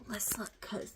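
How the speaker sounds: unvoiced whisper to herself; whispered aside. Real, not acted. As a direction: breathe it as a private note. Checking the date, hushed aside.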